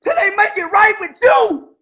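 real indignation, pushed up loud and quick; heated rant. Real, not acted. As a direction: rail at the industry on his friend's behalf. Ranting on another's behalf.

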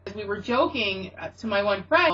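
usual speaking voice

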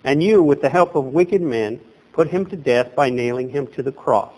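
ordinary talk, nothing marked

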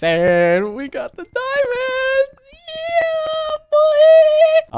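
drawn out, then very high whoop; triumphant cheer. Real, not acted. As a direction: celebrate the diamonds loudly. Obtains the diamonds.

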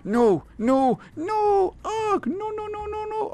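very high, hammed-up wailing noes; squeaky play-acted anguish; mock despair. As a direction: wail it like a cartoon panic. Mock despair over missing catnip.

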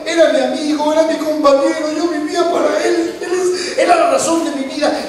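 high, pleading acted lament; grieving outpouring. Mary telling Jesus about her beloved, lamenting. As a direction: pour it out in acted grief. Woman pouring out her grief.

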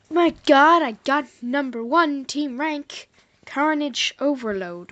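very loud, slow thrilled shout; excited yell. Real, not acted. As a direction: celebrate the rank at full volume. Thrilled at top team rank.

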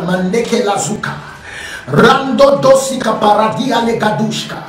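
speaking in tongues. Real, not acted. Pastor praying over the congregation, speaking in tongues. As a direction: let unknown syllables pour out in prayer. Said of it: "I speak in the first syllabic tongues".

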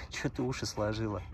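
ordinary talk, nothing marked

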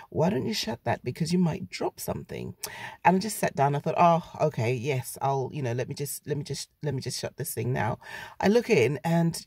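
ordinary talk, nothing marked

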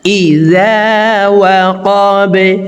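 slow, chanted Arabic recitation; Quran recitation. Shown as a demonstration. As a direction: draw each word out in tajweed style. Recites the verses.

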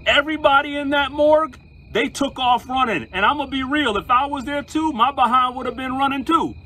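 a preacher getting worked up over the story's climax; excited. Real, not acted. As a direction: tell the payoff with rising excitement.

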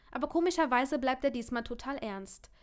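ordinary delivery, nothing marked

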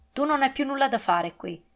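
harsh. Acted. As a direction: spit it out with cold contempt. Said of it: "gli disse con asprezza".

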